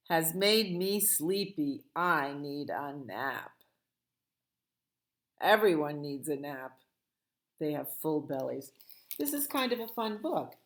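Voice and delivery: plain reading voice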